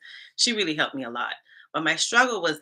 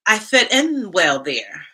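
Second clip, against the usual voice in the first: slow, emphatic; 8 dB louder, much slower than usual. Real, not acted.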